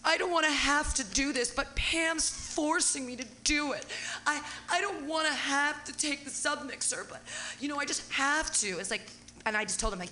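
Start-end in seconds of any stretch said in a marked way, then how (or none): 0.0-8.8 raised, put-on protesting voice; mock reluctant excuse. Man mimics the guy taking back his mixer, mock protesting. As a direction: imitate someone making a reluctant excuse, higher pitched.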